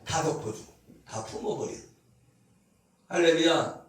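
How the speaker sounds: ordinary delivery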